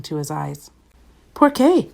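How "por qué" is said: POR QUÉ asked high and loud; asking. A narrator's reading. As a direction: ask POR QUÉ with the pitch shooting up. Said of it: "asked Scapito".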